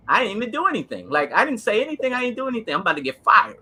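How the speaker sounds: replaying the fear of a young cop about to be fired; retold scared panic. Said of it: "so I'm, I'm scared".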